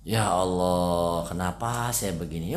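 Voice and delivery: long, drawn-out mock lament; mock complaining. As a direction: act out someone moaning about their lot, stretching the words. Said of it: "jangan ngeluh".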